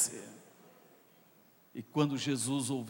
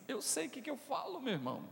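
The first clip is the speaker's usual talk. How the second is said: usual speaking voice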